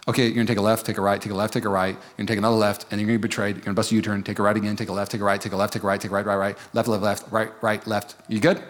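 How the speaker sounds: a hint of Jesus reeling off turns; Jesus giving directions. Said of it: "you're talking to Jesus".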